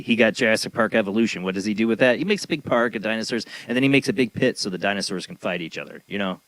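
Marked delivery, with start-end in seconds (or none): none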